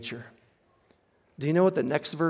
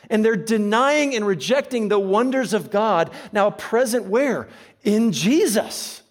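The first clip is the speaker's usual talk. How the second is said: louder, higher, building sermon voice; preaching at full force; 6 semitones higher, 7 dB louder than usual. Acted. Preacher building to a climax about Jesus, louder. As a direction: drive the point home to the congregation.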